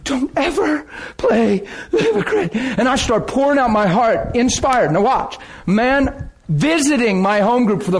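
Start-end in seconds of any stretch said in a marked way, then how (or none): 0.0-2.5 crying out, laughing. Retold or imitated. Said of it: "I'm crying".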